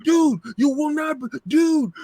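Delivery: high, loud, frantic mock yelling; imitating Boom's excitement. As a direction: squeal it like a friend losing his mind over the news.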